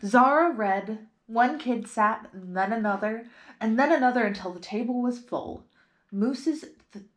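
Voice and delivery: plain reading voice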